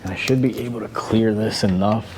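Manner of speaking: normal talking voice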